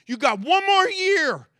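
high, loud final warning; God giving a final warning, loud and stern; stern ultimatum. Retold or imitated. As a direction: lay down the last chance, shouting. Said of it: "where God says".

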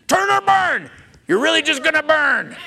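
high, loud blunt hellfire warning; mock hellfire preacher. Jonah's truncated sermon to Nineveh, loud mocking hellfire. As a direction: hammer out a gleeful turn-or-burn threat. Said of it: "His truncated version".

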